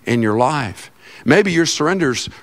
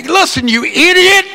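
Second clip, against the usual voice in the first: high, loud exasperated holler; mock screaming; 5 dB louder than usual. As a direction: holler at a stupid machine in mock rage. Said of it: "when you're screaming at a computer".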